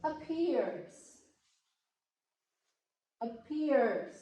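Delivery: "appears" with a strong PEARS, falling at the end; teacher's demo of "appears", stressed on PEARS; second-syllable stress. Shown as a demonstration. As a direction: keep the A light and lean on PEARS in "appears". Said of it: "the second syllables stressing more".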